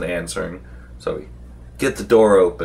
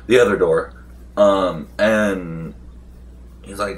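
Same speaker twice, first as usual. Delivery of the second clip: ordinary delivery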